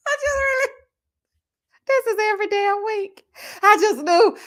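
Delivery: high laughing words; breathless high laughter. Real, not acted. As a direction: talk through a giggle fit. Laughing at every damn week.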